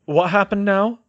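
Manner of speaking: loud interruption; cutting in. A narrator's reading. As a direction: cut in loudly. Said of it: "Arthur cut in".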